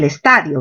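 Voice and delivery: estadio said as es-TA-dio; stress on TA. Shown as a demonstration. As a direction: land the force on ta in estadio. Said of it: "Mayor fuerza en ta".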